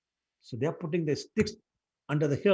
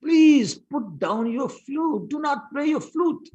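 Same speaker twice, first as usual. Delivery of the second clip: loud, high, slow shouted plea; shouting; 13 semitones higher, 10 dB louder, much slower than usual. Acted. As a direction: shout to stop him in alarm. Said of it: "jumped up again and shouted".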